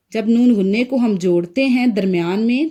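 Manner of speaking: ordinary delivery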